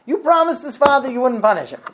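high, loud reproach in a changed voice; urgent rebuke. Acted. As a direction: cry out the reminder in alarm. Bystander rebukes rabbi.

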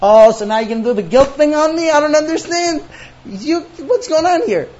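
loud, high, exasperated protest; indignant rant. Acted. Husband accusing wife of guilt-tripping him, indignant rant. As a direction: pile up the accusations at full voice.

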